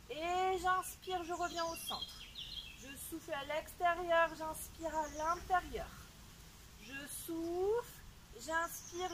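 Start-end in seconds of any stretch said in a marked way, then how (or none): 0.1-1.2 slow breath cue. Shown as a demonstration. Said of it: "coach paces the breathing". 6.8-9.1 slow breath cue. Shown as a demonstration. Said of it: "coach paces the breathing".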